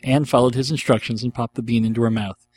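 plain reading voice

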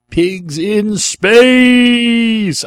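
loud, high, hugely drawn-out announcer call; mock show announcer. Host reads out a Muppet Show question, as booming announcer. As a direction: stretch SPACE out long and loud.

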